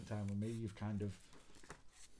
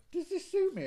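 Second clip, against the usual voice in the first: much higher, louder put-on query; mock clothes-shopping question; 9 dB louder than usual. Imitating partner asking about an outfit, high put-on question. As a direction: squeal it out as an eager imitated shopper.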